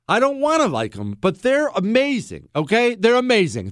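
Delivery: high, insistent declaration; emphatic admission. Real, not acted. Host confessing his beer love, exasperated. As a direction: declare it against your will. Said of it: "There, I said it".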